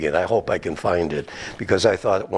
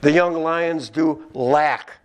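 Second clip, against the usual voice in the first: slow, raised reading voice; solemn raised Psalm reading; scripture reading; 6 semitones higher, slower than usual. Shown as a demonstration. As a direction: read the Psalm out with weight.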